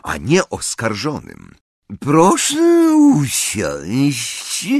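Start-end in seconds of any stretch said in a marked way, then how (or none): 2.0-4.7 high, slow, drawn-out velvety voice; silky sweetness, stretched out and pitched up; velvety villainess. A narrator's reading. Ambridge orders the accused to sit, velvety and drawn-out. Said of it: "swoim cichym, aksamitnym głosem".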